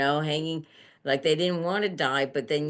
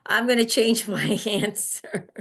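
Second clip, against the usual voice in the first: laughing; 5 semitones higher, 4 dB louder than usual. Real, not acted. Laughs at own reversal.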